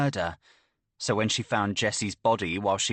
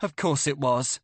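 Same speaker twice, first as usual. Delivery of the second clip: sarcastic; 5 semitones higher than usual. A narrator's reading. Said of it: "rolling her eyes".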